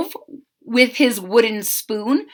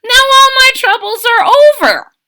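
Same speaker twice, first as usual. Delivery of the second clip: high, loud, gleeful declaration; triumphant; 13 semitones higher, 10 dB louder than usual. A narrator's reading. Cook gloating over his nailed-up sign, high and loud. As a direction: crow that the problem's solved.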